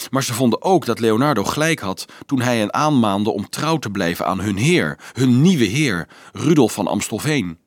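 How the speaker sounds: usual speaking voice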